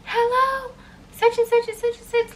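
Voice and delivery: mock screaming. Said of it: "she starts screaming at me".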